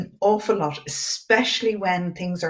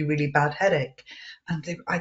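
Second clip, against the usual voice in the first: usual speaking voice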